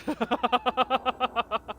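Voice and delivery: high rapid giggling; laughing. Real, not acted. Player amused by her pregnant animal skit, laughing. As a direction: crack up in a run of giggles.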